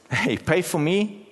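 high, drawn-out demand; demanding big guy. Retold or imitated. Big guy in the queue to the wife, demanding. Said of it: "This big guy that says".

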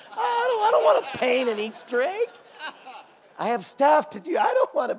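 high, laughing whine; laughing protest. Acted. Refusing to paint Easter eggs, high laughing whine. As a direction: whine through a laugh like a sulky kid.

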